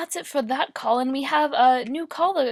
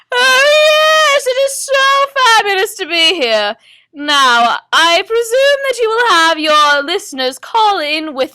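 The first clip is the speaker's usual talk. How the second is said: high, loud, gushing psychic; Madame Dubois; 7 semitones higher, 8 dB louder than usual. Acted. Psychic greets the studio host, theatrical gushing. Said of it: "hosted by Madame Dubois".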